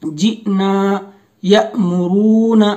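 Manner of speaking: यअमुरूना slow, glottal catch after य; slow tajweed reading; hamza jolt. Shown as a demonstration. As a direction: recite with a throat stop after य. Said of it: "झटका होता है".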